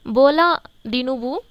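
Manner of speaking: बोला दिनुबू said slowly with a rise on बोला; name spelled out slowly. Shown as a demonstration. Teacher gives students the president's name, slow and clear. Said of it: "नाम उनका याद रखो".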